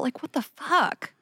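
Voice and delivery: high, slow, appalled; outraged disbelief. Real, not acted. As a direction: drag out the disbelief. Outraged rhetorical question.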